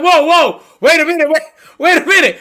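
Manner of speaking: high, loud wait a minute; high-pitched panicked protest in court. Retold or imitated. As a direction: hold them off, alarmed and loud.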